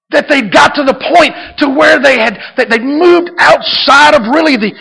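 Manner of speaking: high, loud, fervent preaching; full-force preaching. Acted. Pastor urging the church to expect a miracle, fervent preaching.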